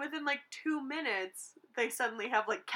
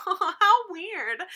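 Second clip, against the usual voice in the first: puzzled waitress; 9 semitones higher, 9 dB louder than usual. Retold or imitated. Mimicking waitress's puzzled reaction.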